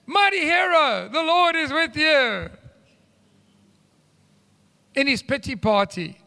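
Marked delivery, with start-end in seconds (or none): 0.1-2.5 high, slow, rousing call; encouraging angel. Acted. Angel hails downcast Gideon as a hero, encouraging. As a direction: lift him up with a slow, high announcement. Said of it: "He's encouraging".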